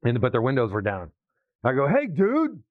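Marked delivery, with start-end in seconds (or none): 1.9-2.6 calling out. Real, not acted. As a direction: hail him as he drives by. Calling out to a passing driver.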